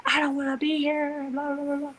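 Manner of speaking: high, childish-style mock whine; mock whining. Stubborn labouring woman refusing the gown, mock whining.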